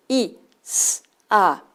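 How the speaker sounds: i, s, a said one at a time; isolated sounds. Shown as a demonstration. Teacher splitting tiza into sounds. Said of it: "Sonidos t".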